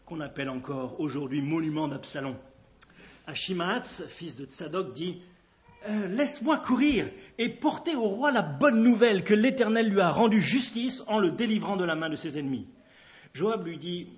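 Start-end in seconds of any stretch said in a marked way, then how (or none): 5.9-12.7 plus fort et plus haut au début, élan de messager; ardeur suppliante. A narrator's reading. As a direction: supplie avec ardeur de porter la nouvelle. Messager impatient supplie de courir. (supplique pleine d'ardeur)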